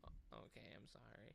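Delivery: whispered sheepish apology to the cat. Retold or imitated. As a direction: whisper it meekly like a scolded kid. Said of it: "I'm like".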